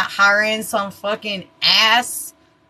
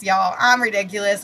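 ordinary talk

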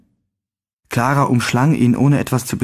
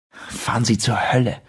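snarled defiance; 4 dB quieter than usual. A narrator's reading. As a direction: spit the curse back, hurt but defiant. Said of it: "fauchte ich".

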